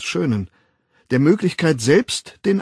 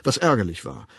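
ordinary delivery

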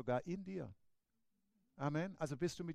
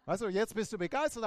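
ordinary delivery

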